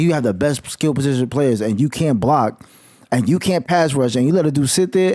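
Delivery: ordinary talk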